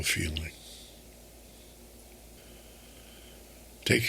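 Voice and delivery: calm, unhurried meditation voice with long pauses; guided meditation. Acted. Host guiding listeners through a quarantine meditation, calm and unhurried. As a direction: guide them gently, phrase by phrase, leaving space to breathe.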